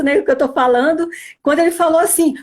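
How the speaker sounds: usual speaking voice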